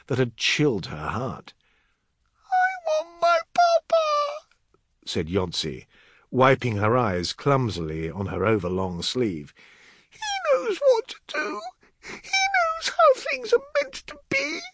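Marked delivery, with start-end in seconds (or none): 2.5-4.5 put-on slow, very high little-girl plea; crying child. A narrator's reading. Girl wiping her eyes, tearfully wanting papa. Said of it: "Yonsei was crying loudly". 10.1-14.6 put-on high, tearful little-girl voice; crying child. A narrator's reading. Crying girl trusting in her papa, tearful. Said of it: "Yonsei was crying loudly".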